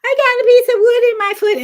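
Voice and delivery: put-on high, loud, fast crying little boy; crying child. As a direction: wail to daddy like a hurt small boy. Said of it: "he went in crying".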